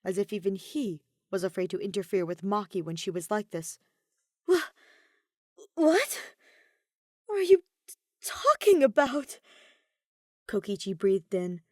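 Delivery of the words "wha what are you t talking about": stammering shock. A narrator's reading. Stunned, stammering disbelief.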